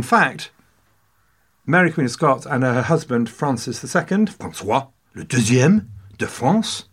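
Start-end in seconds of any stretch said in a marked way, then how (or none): 4.3-6.8 French pronunciation. Acted. Switches into French name.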